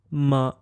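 MA short, light and toneless; neutral tone. Shown as a demonstration. Teacher demonstrates the fifth tone, short and toneless. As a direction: clip MA short at mid pitch. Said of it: "toneless and shortened".

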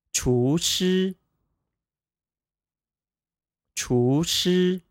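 厨师 said chú shī, rising then high level; 厨师 with second tone then first tone; practicing tones. Shown as a demonstration. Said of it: "second tone, first tone pair".

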